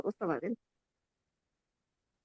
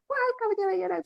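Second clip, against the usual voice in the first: scolding; 12 semitones higher, 3 dB louder, faster than usual. Retold or imitated. Mother scolding.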